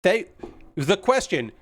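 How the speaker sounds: ordinary delivery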